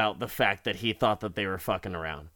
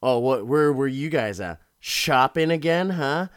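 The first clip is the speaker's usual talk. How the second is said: louder, sarcastic mock interrogation; mock-angry accusing questions; acted pissed off; 2 semitones higher, 6 dB louder than usual. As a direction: needle them loudly, playing annoyed. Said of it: "he's all pissed off".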